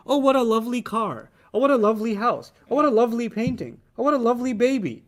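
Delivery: mock gushing over lovely things; a touch of delighted admiration; admiring. Shown as a demonstration. Teacher acts out admiring each lovely thing.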